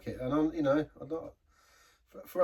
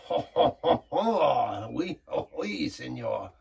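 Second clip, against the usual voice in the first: hammed-up jolly ho ho ho in a put-on French accent, louder; mock French greeting; 4 dB louder, much slower than usual. Beer reviewer jokes about France in a mock French accent. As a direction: play a cheesy Frenchman, booming a bit. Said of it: "Is it senor?".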